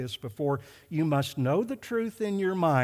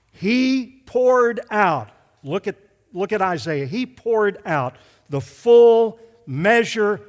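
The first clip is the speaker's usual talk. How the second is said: slow, loud, thundering declaration; loud, drawn-out sermon climax; full-force preaching; 8 dB louder, much slower than usual. Real, not acted. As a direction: pound out each phrase with long stretched words.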